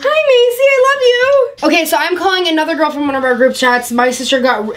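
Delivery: ordinary talk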